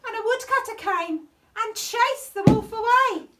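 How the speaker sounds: plain reading voice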